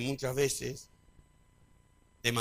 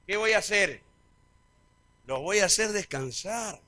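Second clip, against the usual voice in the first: preached quote of Jesus; 8 semitones higher, 13 dB louder than usual. Acted. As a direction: proclaim it like a revival preacher. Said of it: "¿Qué dijo?".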